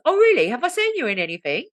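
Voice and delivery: high, mock British surprise; imitated British small talk; British accent. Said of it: "not allowed to do British accent".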